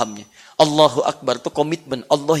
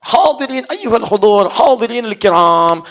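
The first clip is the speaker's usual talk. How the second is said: slow, louder, formal Arabic opening; formal Arabic address; 3 semitones higher, 4 dB louder, slower than usual. Retold or imitated. Preacher mimicking a formal Arabic speech opening, slow.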